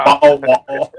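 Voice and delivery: high put-on British accent, no r. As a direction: say it like a Brit and drop the r. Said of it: "you can't say your R's when you're talking British".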